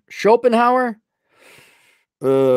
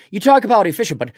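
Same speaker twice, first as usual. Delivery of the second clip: ordinary talk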